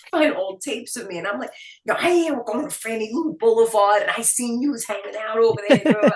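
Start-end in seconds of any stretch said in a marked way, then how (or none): 1.8-6.1 put-on thick, loud old-school Queens accent. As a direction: lay on a raised, punchy Queens accent. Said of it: "lose my Queen's accent".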